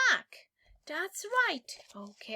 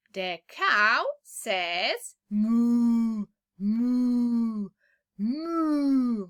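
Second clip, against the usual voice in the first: cow mooing; 2 semitones lower, 5 dB louder, much slower than usual. Shown as a demonstration. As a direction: moo like a cow for a child. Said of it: "teacher imitates the cow".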